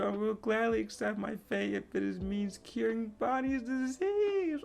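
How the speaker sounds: high, cracking, teary voice; hint of sobbing gratitude; tearful Kuma. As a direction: thank them through tears. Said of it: "he's crying".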